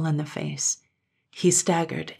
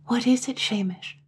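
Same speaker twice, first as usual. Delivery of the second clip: hushed and uneasy, a touch higher; frightened whisper; 5 semitones higher than usual. A narrator's reading. Katrine whispering to the man she thinks is Shamish. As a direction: whisper the question, afraid of the answer. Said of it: "she whispered".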